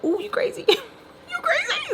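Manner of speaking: very high squeal breaking into laughter; retold shock in a squeaky, soft high voice; shook. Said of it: "I was shook".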